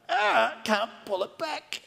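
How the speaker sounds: high strained cry of a frozen-handed king; straining king. Acted. Preacher acting the king with a paralysed hand, strained cry. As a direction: strain as if your arm is stuck, then cry out. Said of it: "I might just stop acting like that".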